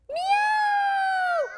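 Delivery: very high, loud drawn-out meow; a long cat's mew. Shown as a demonstration. Reader meows to show the child how. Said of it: "Can you meow?".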